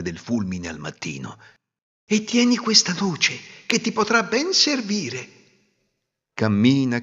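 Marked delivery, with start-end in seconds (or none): 2.1-5.3 lightly voiced, higher hurried warning; urgent old woman. Old mother of the wind urging the girl to flee. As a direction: hurry her off kindly.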